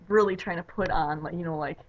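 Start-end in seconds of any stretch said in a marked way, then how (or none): none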